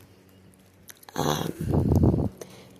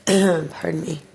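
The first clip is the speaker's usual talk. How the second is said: clearing her throat, choked apology; strained "Pardon me" through a tight throat; 2 semitones higher, 4 dB quieter than usual. Real, not acted. Apologizing to viewers while her throat feels choked. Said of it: "I'm, like, being choked".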